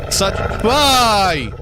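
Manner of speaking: high, loud anguished why; anguished cry. Real, not acted. Streamer crying out at the game attacking him, high loud anguish.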